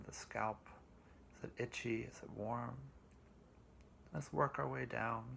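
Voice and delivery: slow, calm meditation voice with long pauses; guided meditation. Acted.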